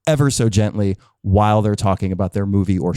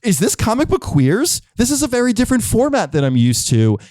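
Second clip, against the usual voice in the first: high-pitched mock bewilderment; put-on higher voice of confused audience; baffled listener; 10 semitones higher than usual. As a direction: act out a puzzled, taken-aback fan in a raised pitch. Imagined listeners' baffled reaction.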